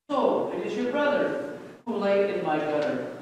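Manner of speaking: ordinary delivery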